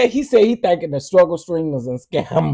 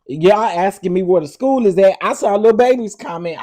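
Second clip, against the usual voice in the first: ordinary delivery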